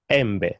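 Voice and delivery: "embe" said em-beh, short e sounds; Swahili e vowel. Shown as a demonstration. Teacher modelling the Swahili word for mango.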